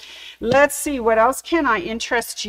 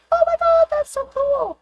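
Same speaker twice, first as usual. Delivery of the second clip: high squealed gasp of delight, imitating customers; mock amazement; 4 dB louder, faster than usual. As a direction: play a wowed shopper, pitch way up. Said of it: "Everybody looks at 'em and goes".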